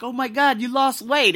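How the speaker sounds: high, loud excited greeting; retold gushing surprise; joking amazement. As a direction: exclaim it to tease a friend.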